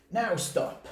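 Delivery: high, sharp order; firm command. A narrator's reading. Max ending the rumpus, firm high command. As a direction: stop them dead. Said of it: "Max said".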